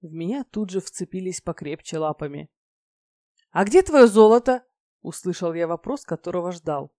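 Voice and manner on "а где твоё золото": very loud, higher, insistent question; eager demand. A narrator's reading. Goddess clutching the dragon who promised gold, eager loud question.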